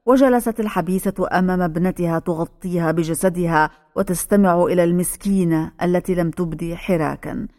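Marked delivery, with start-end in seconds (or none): none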